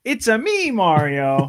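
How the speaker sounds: high-pitched catchphrase, dropping back on the name; sing-song game hero impression; Mario. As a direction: imitate the video game plumber's cheery greeting. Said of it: "at one point he said".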